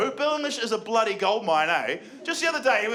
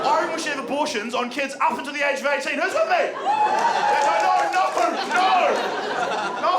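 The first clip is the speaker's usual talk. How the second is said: higher, louder rally cry breaking into panicked no; rallying shout; 7 semitones higher, 4 dB louder than usual. Acted. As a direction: whip up the crowd then back off fast. Rallying crowd, then retracting.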